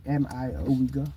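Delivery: usual speaking voice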